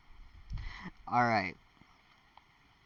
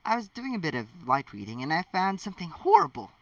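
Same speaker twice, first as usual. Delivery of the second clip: stiffly; 6 semitones higher than usual. A narrator's reading. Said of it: "Hermione said stiffly".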